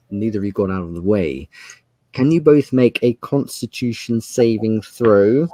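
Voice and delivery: usual speaking voice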